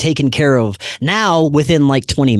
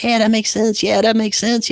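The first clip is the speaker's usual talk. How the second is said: smug agreement; 10 semitones higher than usual. Retold or imitated. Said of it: "And I'm like".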